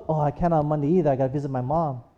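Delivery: low, soft, hurried brush-off; a hint of an evasive boyfriend, low and fast; sheepish excuse. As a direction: mumble the excuse, fast and apologetic. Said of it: "And then he goes".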